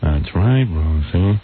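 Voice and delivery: low, dull guest impression; George Clooney. Imitated guest answering Rosie about Batman, low dull drone. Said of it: "this is how he talks".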